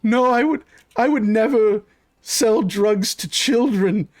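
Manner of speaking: high-pitched, protesting suspect voice; earnest denial. Acted. Suspect denying the guard's accusation about kids, high protest. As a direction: deny it high, dragging out the last words.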